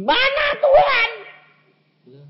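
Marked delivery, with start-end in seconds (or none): 0.0-1.1 loud, high demand, leaping up on the question; hammed-up complaining outburst; mock grumbling. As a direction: imitate an impatient believer shouting at God.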